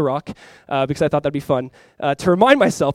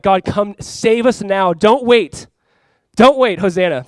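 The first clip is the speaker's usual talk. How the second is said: pleading cry, slow then louder; 3 semitones higher, 6 dB louder, slower than usual. Retold or imitated. Crowd begging God to save now, a loud pleading cry. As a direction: cry out to God, don't wait. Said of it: "There's a cer-, certain cry of".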